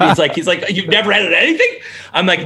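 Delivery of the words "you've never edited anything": high-pitched incredulous question, imitated with a laugh; laughing disbelief. Hiring boss to a would-be editor with no experience, laughing disbelief. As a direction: imitate his amused disbelief, rising at the end. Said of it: "and he laughed".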